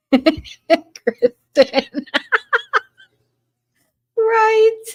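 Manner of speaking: high, giggling through the name; laughing. Real, not acted. Reading an answer from chat, laughing hard. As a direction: laugh right through the word.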